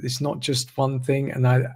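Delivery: normal talking voice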